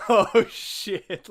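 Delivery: loud, high shocked cry; shocked yell. Retold or imitated. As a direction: blurt it out in shock.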